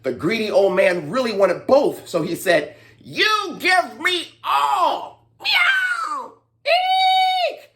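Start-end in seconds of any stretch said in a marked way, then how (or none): 3.0-5.3 high slow drawn-out demand; greedy demand. Acted. Greedy old man demanding both baskets. 5.4-6.3 very high meow; cat meow. Acted. Greedy old man meowing to scare the mice. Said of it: "meowing like a cat". 6.7-7.7 very high squeak; mouse squeak. Acted. Frightened mice squeaking at the meow.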